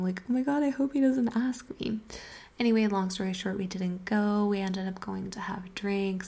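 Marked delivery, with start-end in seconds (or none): 0.3-2.0 higher-pitched inner cringe; cringing. Retold or imitated. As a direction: say it as a squirming secret worry, voice raised. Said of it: "Secretly cringing and saying".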